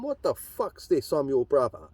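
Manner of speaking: high-pitched, baffled demand; mock outraged "what the fuck"; incredulous retold challenge. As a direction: throw it at him in disbelief. Retold confronting on phone.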